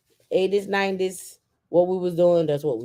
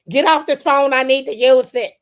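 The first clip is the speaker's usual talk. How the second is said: loud scolding mama, higher; mama yelling; 6 semitones higher, 8 dB louder than usual. Retold or imitated. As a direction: bark it like an annoyed mom. Mama snatching phone, yelling.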